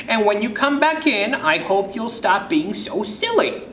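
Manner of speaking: high-pitched chiding granny; grandma's high, mild reproach; grandmother voice. Acted. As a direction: lilt it up high as a fussing grandma. Grandmother gently chiding child.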